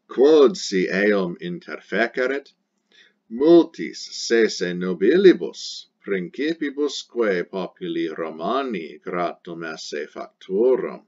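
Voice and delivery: loud, slow, theatrical recitation of the Latin; dramatic Latin reading. Shown as a demonstration. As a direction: declaim it like an orator on the rostra. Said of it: "dramatic reading of Latin passage".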